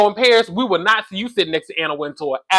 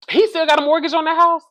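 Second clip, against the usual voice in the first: much higher, disbelieving ask; can't believe what she just heard; incredulous question; 10 semitones higher than usual. Real, not acted. Vlogger doubting the mortgage story, pitched way up in disbelief.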